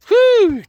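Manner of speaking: a loud, high winded exhale; breathless whew. Real, not acted. Out of breath from pollen, a loud real whew. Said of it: "I apologize when I'm huffing and puffing".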